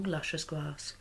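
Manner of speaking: usual speaking voice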